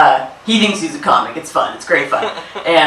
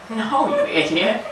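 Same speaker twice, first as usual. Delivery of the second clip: slow, quieter mock put-down; mock snap; 4 semitones higher, 7 dB quieter, slower than usual. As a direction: drag out a smug put-down, laughing. Snapping back at clueless people.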